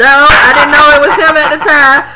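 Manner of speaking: loud, high, laughing disbelief; excited. Real, not acted. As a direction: shout it out in amused shock. Hyped laughing retelling of episode.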